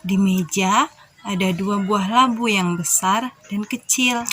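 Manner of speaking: ordinary delivery, nothing marked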